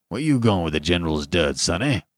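low, reproachful sentry voice. A narrator's reading. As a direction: challenge the youngster with mild reproach. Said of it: "The sentry eyed him reproachfully".

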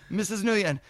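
ordinary talk, nothing marked

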